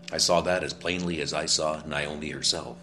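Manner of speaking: normal talking voice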